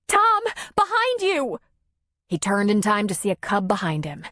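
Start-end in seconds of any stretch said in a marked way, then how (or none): 0.1-1.6 very high, drawn-out warning cry; panicked yell. A narrator's reading. Nora warns Tom of a cub behind him, panicked yell. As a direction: yell a frantic warning in a high, slow stretch. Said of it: "bolted behind the nearest tree and yelled".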